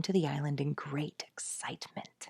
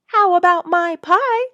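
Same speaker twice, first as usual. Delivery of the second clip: indignant; 13 semitones higher, 13 dB louder than usual. A narrator's reading. Father protests lost pie.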